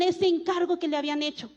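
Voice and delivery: ordinary delivery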